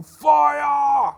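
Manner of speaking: high, loud, slow panicked screaming; screaming fire alarm. Acted. Quackbane fakes panic to raise the alarm, screaming high and loud. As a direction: scream like the whole town must hear. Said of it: "as he's screaming".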